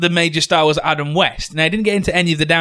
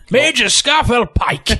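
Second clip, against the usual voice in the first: army major voice; 5 semitones higher, slower than usual. Pretend. Joke army major title.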